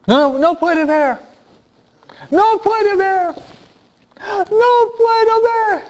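high, strained comic wail, increasingly drawn out; hammed-up high-pitched shouting; imitated Curly yelling. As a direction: cry out like a slapstick stooge, pitch climbing. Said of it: "él gritaba".

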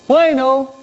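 high telephone hello; contestando el teléfono. Pretend. Acts answering the phone. (voz aguda al contestar una llamada)

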